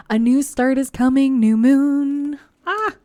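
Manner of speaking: thrilled reading the card aloud; excited. Real, not acted. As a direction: announce it like great news.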